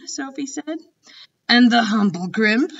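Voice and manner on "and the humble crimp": louder, higher giant's voice, listing with relish; a giant naming strange beasts; BFG. Acted. The giant quizzing Sophie, loud and pleased.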